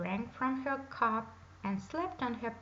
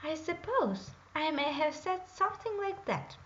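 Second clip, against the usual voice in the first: grumbling princess; 6 semitones higher than usual. A narrator's reading. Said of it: "grumbled the princess".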